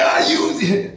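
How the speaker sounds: high, playful fake flare-up; mock emotional outburst. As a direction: pretend to get all worked up, half laughing. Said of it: "a big emotional tizzy".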